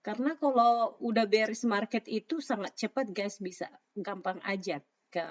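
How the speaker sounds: ordinary talk, nothing marked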